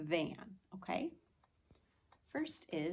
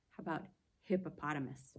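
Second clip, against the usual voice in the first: ordinary delivery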